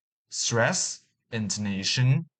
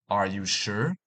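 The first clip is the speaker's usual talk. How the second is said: normal talking voice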